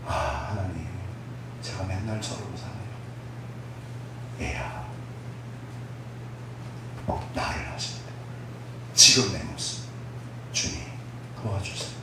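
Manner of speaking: hushed, slow, near-whispered plea; crumpled prayer. Retold or imitated. Preacher crouched in a corner pleading with God, hushed. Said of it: "구석에 쭈그리고 앉아가지고".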